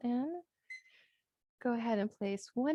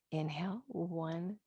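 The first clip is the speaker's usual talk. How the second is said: soft, slow breathing count; guided breath count; 6 dB quieter, much slower than usual. Acted. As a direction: count the breaths gently and slowly.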